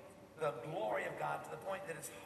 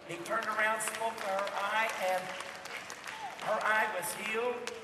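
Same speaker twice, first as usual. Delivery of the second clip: louder, higher fervent preaching; preacher listing miracles, passionate; preaching at full force; 4 semitones higher, 6 dB louder than usual. Acted. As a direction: proclaim each healing with fire. Preaching the healings.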